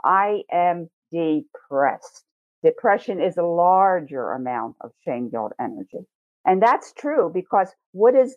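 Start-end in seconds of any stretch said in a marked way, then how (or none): 0.0-2.2 "I am depressed" said very slowly; slow heavy statement. Shown as a demonstration. Teacher voicing the weight of depression, said slowly. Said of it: "Everyone's gonna hurt me".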